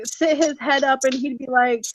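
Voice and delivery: usual speaking voice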